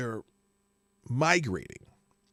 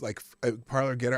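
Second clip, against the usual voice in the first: ordinary talk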